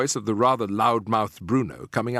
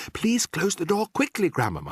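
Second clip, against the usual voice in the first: high, pressing request; high-pitched urging; urgent plea; 8 semitones higher than usual. A narrator's reading. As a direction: beg her anxiously, falling on the last word. Mouse begs before maid comes.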